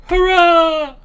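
cheer. Pretend. Mock cheer, high and loud.

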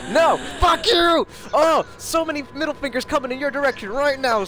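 high squealing yell; laughing shout. Real, not acted. Laughing outrage at friend.

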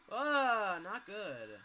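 loud high yelp of fright; jolted by a jump scare; scared yelp. Real, not acted. Jump scare in horror game.

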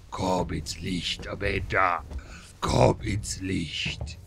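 slow, low eerie call; eerie luring voice. Pretend. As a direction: draw her in slowly, spooky. Luring voice calling into light.

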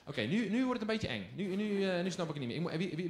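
ordinary talk

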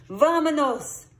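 high, loud call to go; rally the gang, pitched high; eager rallying cry. A narrator's reading. Kitty urges the gang onward.

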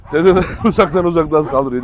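ordinary talk

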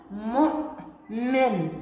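مؤمن stretched very slowly, each sound held; slow drawn-out demo. Shown as a demonstration. Teacher slowly sounding out a word for the pupil Hala. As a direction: draw out مؤمن so the pupil hears it.

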